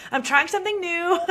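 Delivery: sheepish laugh while explaining; laughing sheepishly. Retold or imitated. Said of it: "I'm like".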